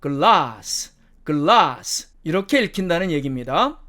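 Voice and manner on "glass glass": British "glass" with long ah; British demo of "glass", open a; British accent. Shown as a demonstration. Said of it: "영국식 발음으로는".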